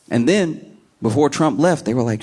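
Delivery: usual speaking voice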